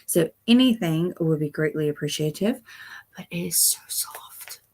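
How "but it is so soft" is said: breathy hushed whisper about the yarn. Real, not acted. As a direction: hush it like a secret, barely voiced. Whispering over soft yarn.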